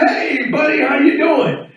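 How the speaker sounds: friendly yell. Retold or imitated. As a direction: call out a hearty greeting across the street. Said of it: "So I see him and yell".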